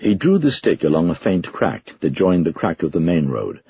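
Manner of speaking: plain reading voice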